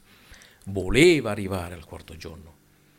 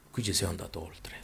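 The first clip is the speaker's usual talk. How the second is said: much softer, near whisper; hushed repeat; 3 semitones lower, 12 dB quieter than usual. Real, not acted. Preacher repeating the key point to his listeners, hushed. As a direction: drop to a hushed undertone.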